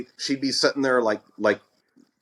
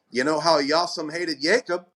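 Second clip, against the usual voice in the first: mock proclamation; 6 semitones higher than usual. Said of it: "And she'd say".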